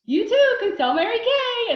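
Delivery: high, loud, bubbly sing-song; chirpy sales pitch. Retold or imitated. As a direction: sell it like a cheerful hostess. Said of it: "I'm like".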